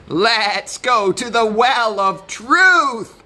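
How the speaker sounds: high, loud goat proposal; goat character. A narrator's reading. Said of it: "said the goat".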